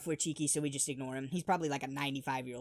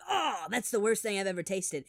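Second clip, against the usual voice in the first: disgust; 5 semitones higher, 5 dB louder than usual. Retold or imitated. Disgusted reaction to pickled garlic.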